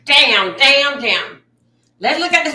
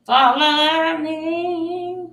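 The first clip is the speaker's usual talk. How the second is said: slow drawn-out call; 4 dB quieter, much slower than usual. Acted. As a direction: stretch out follow me, very slowly. Dramatic slow come-along call.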